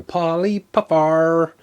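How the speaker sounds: Polly Puffar drawn out with a fond lift; savouring the name. Shown as a demonstration. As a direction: roll Polly Puffar out slowly, enjoying it. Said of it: "I just like the name".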